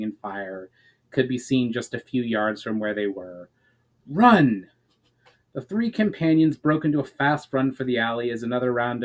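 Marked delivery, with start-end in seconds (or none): 4.1-4.7 louder, sharp call to run; a sudden warning shout as arrows fly; urgent shout. A narrator's reading. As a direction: bark the order at the others. Tall one orders a sudden run.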